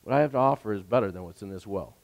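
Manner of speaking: soft, low and quick reassurance; gentle. Retold or imitated. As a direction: say it gently, almost in passing. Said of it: "he kind of just gently says".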